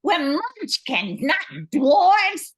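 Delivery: rising exasperation. Real, not acted. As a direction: start by telling him off and end up nearly shouting. Host stops co-host's silly singing.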